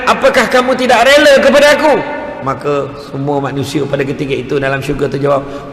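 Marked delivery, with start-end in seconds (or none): 0.1-1.9 loud, high, ringing question; challenging divine question. Retold or imitated. God questioning the people of paradise, loud high voice. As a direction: put it to the whole crowd forcefully. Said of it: "Allah Ta'ala tanya".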